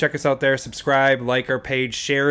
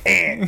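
put-on raised, short kid's "eh"; little "eh" noise; 5 semitones higher than usual. Dad showing friend his son's habit, little "eh". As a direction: imitate a child's little in-trouble sound. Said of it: "he just does this little".

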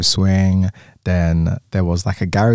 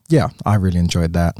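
ordinary delivery

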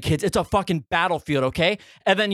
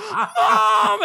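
mock wail for mother; kid screaming; 4 dB louder than usual. Hurt kid calling for his mother, imitated scream. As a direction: cry it out loud like a hurt child.

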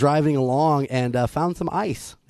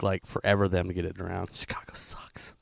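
soft whispered gripe; whispered aside; 6 semitones lower, 9 dB quieter than usual. Real, not acted. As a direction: drop to a whisper to grumble. Whispered aside about Chicago.

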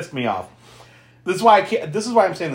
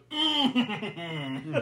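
long soft mock-pleasured "mmm"; drawn-out, higher hum with a giggle; savoring hum; 5 semitones higher, 10 dB quieter than usual. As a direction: hum it as if tasting something delicious. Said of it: "hugs himself with his long arm and goes".

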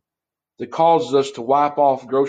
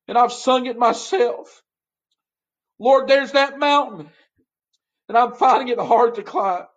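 preaching at full force, higher and rising; 8 semitones higher than usual. Acted. Pastor to child of God, impassioned preaching. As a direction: pastor preaching hard to his flock.

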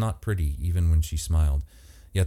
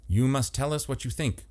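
snapped; 9 semitones higher, 3 dB quieter than usual. A narrator's reading. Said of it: "Miranda snapped".

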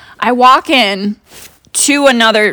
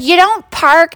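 mock yelling lady; 8 semitones higher than usual. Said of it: "starts yelling at the guy".

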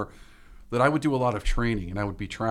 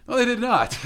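laughing; 10 semitones higher, 5 dB louder than usual. Real, not acted. Laughing admission about French jokes.

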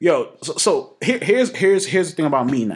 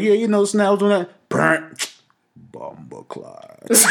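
put-on Jamaican accent; 5 semitones higher, much slower than usual. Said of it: "you come out your Jamaican accent".

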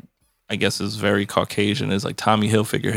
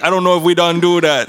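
mock Jamaican cook; 7 semitones higher, 5 dB louder than usual. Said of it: "They're like".

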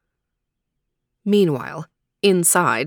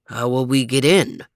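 much lower, big-dog voice; deep dog voice; 6 semitones lower than usual. Acted. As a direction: rumble the question low. Overton asks.

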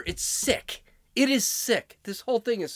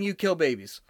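ordinary talk, nothing marked

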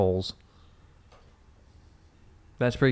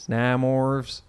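ordinary talk

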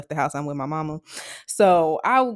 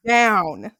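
ordinary talk, nothing marked

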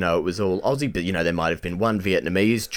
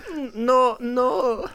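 much higher, slow mock Chinese accent; 13 semitones higher, much slower than usual. Shop worker refusing extra chicken salt, high mock Chinese accent. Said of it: "but I'll do Chinese".

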